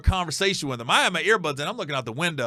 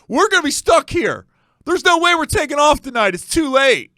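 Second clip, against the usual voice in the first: much higher and louder, complaining to everyone; loud drunk griping; 11 semitones higher, 8 dB louder than usual. Retold or imitated. Drunk man loudly tells the passengers they're stuck. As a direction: broadcast doom to the whole cabin. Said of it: "he's trying to tell people".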